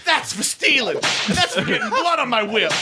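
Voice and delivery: chicken voice. Retold or imitated. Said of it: "the chicken whipping somebody going".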